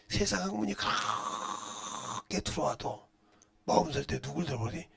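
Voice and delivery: ordinary talk, nothing marked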